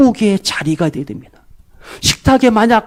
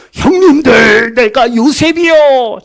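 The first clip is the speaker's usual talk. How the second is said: slow, louder cry of revelation; 3 semitones higher, 4 dB louder, slower than usual. Retold or imitated. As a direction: call it out to his brothers slowly. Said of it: "막 큰 소리로".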